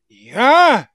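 ordinary talk, nothing marked

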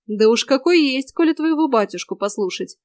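ordinary delivery, nothing marked

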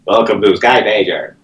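flat automated phone menu voice; robot voice. Retold or imitated. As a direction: read it like a machine greeting. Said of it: "Like the robot voice".

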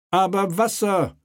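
high, pointed retort; sharp king. Acted. King snaps back at the mayor, pointed and high. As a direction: jab the word back at him. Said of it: "rief Friedrich spitz".

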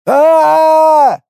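long, high scream; loud high yell. A narrator's reading. Faking panic as the walker leaps off, loud yell. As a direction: scream out at full voice. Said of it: "заорал Ит".

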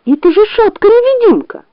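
high, piping fox voice; high-pitched fox outburst; indignant fox. A narrator's reading. Fox corrects bear angrily.

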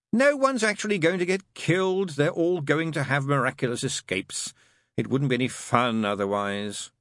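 high, strained start easing downward; demon grudgingly reassuring. Acted. Crowley reassuring Aziraphale nobody gets killed, wretchedly. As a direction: protest it, then settle as you explain.

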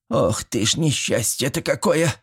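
put-on fretful old-woman keening; lamenting old woman. A narrator's reading. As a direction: bemoan it in a high, softish voice. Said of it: "запричитала бабка".

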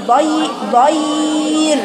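ضير stretched slowly, the ر held at the stop; pronouncing ضير. Shown as a demonstration. Teacher modelling the stop on ضير, slow and stretched. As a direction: draw out ضي- then land on ضير. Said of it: "نقف على كلمة ضير".

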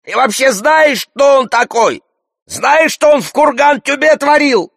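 straining high, loud yell; Зухур. A narrator's reading. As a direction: shout the accusation at full strain. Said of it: "надрывается Зухур". (надрывно кричит, обвиняя)

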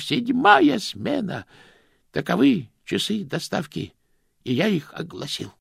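measured ritual reading, shift numbers slowed; solemn statute recitation. Acted. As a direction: intone the rules like a liturgy. Grosh recites the postal statute. (торжественно зачитывает устав)

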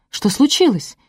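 high, anxious question; alarmed. A narrator's reading. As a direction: ask in sudden worry, pitch jumping up. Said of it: "испугалась я". (испуганно, с тревогой)

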